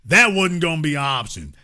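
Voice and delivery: ordinary delivery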